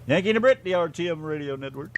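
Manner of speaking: high, loud show ident; radio ident. Acted. Host announcing the show to listeners, big ident read. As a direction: announce the station name with punch.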